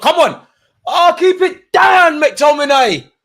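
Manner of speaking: very high, loud, drawn-out yell; anguished shout. Real, not acted. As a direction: yell in disbelief at a missed chance. Reacts to missed chance.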